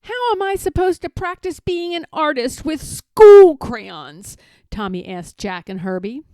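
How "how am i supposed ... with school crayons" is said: put-on high, loud upset child; a boy pleading against the rule; child protesting. A narrator's reading. Tommy protests in story.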